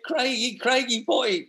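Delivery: acted dad calling down the phone; imitated dad. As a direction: put on an excited dad's voice.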